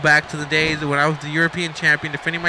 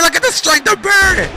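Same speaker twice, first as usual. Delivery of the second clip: very high-pitched burst of commentary; amazed shout; 13 semitones higher, 4 dB louder than usual. Real, not acted. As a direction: cry out in awe at the lift.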